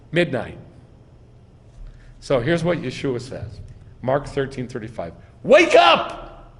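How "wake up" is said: high, loud shout of wake up; ringing emphatic cry; emphatic command. Retold or imitated. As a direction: jolt them awake with a sharp call. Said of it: "It's an imperative, emphatic".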